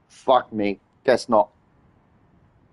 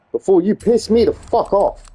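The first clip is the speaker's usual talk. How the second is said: ordinary delivery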